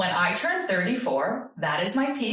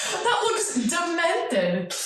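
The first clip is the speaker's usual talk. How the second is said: laughing; 8 semitones higher, much slower than usual. Real, not acted.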